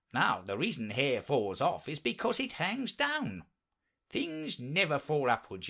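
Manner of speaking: high, lilting knight voice; earnest inventor knight. Acted. Knight explains his invention to Alice, earnest and lilting. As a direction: explain it with swooping, lifted pitch.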